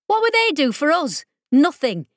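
high, loud scornful outburst; heated scoff. Acted. Ollie argues heatedly.

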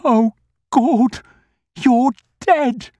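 put-on very high, loud horrified old man. A narrator's reading. Grocer terrified, thinking he sees a dead man, high and loud. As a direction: recoil in terror at a ghost.

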